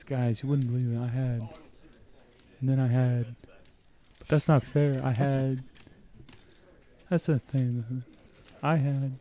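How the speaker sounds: softly voiced losing player, whining lightly; a hint of a sore loser explaining; hushed player's excuses. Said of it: "He says".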